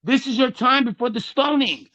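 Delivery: loud, high preacher's command; preaching shout. Acted. Preacher calling the sinner to get up, loud and high. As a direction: thunder it from the pulpit. Preacher declaring sin no more.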